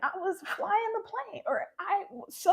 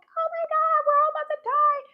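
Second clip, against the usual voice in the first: higher and louder, imitating panicked passengers; mock panic; 7 semitones higher, 4 dB louder than usual. Passengers on a crashing plane, imitated with higher, louder panic. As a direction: play the frantic passengers, half-mocking. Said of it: "everybody else on the plane was just panicking".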